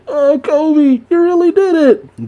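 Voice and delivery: very high, squeaky mock-emotional voice; overjoyed friend. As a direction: imitate a friend overcome with joy. Acts Helmeppo's emotional amazement.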